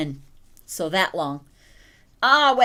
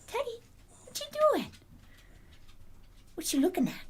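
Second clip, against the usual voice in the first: very high cooing question; puppy talk; 13 semitones higher, 7 dB quieter, much faster than usual. Real, not acted. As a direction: sing it up high to the dog.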